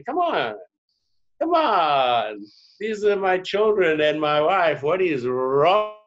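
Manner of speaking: high, pleading exasperation, drawn out at the end; exasperated protest. Acted. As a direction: protest in theatrical disbelief, stretching the last words. Acted protest at racial labelling.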